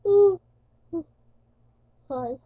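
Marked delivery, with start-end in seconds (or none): 0.1-0.4 high, loud yelp of pain held back; a sharp high pained ooh as the needle goes in. Retold or imitated. Feeling the needle, a high loud pained ooh. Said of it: "trying not to cry".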